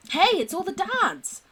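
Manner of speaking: high, loud, stretched-out greeting; delighted recognition. Acted. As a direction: exclaim high and slow on spotting old friends. Spots familiar characters in photo.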